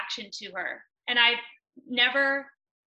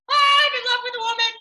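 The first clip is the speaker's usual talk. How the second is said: high, loud, fast wailed confession; bawling confession; 13 semitones higher, 8 dB louder, much faster than usual. Retold or imitated. Bursting into the therapist's office, a high, loud, wailed confession. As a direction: blurt it out through mock tears.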